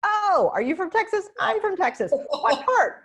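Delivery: high, loud, gushing small talk; Texan abroad greeting a stranger, high and loud with delight; eager Texan greeting. Retold or imitated. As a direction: gush like you just met a fellow Texan far from home. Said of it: "they do that very Texas thing".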